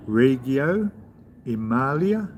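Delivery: put-on Italian accent, lilting upward. Shown as a demonstration. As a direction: say the town with playful Italian flourish. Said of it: "You gotta say that with an accent".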